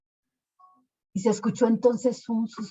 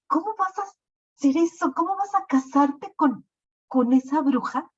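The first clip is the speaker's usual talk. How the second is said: alarmed objection; 8 semitones higher than usual. Acted. Councilor objects to the wedding.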